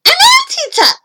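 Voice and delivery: put-on very high, loud boy's yell; loud, high-pitched shout. A narrator's reading. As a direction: yell it out proudly. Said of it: "he yelled".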